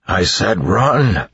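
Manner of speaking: croaked. A narrator's reading. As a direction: force it out through a hurt throat. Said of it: "he croaked".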